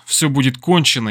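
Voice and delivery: ordinary delivery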